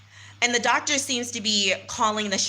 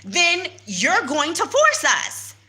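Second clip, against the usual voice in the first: higher, louder heated retort; indignant, pitched-up argument; retold heated retort; 7 semitones higher, 3 dB louder than usual. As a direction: push back hard on the officer. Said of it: "I said".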